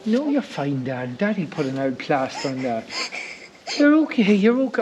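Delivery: calm her down gently. Real, not acted. Daughter fell and hurt herself.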